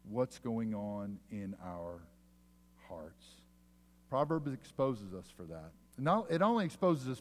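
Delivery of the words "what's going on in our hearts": slow emphatic. Real, not acted. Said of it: "slowing for emphasis".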